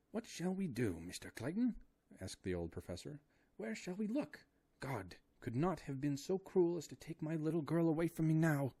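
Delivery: put-on high, anguished old professor; father fearing for his lost daughter, high and distraught. A narrator's reading. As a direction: plead like a frightened old father.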